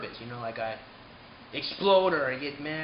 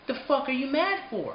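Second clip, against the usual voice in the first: raised, higher, incredulous challenge; exasperated snap; 8 semitones higher, 4 dB louder than usual. Retold or imitated. To angry commenters, an exasperated raised snap. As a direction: fling the question back in disbelief.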